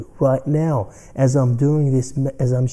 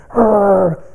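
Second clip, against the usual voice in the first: high, loud yappy mock bark; imitated bark; 9 semitones higher, 6 dB louder than usual. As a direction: yap like a tiny angry dog. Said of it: "it will still bark".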